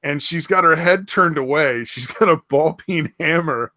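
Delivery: ordinary talk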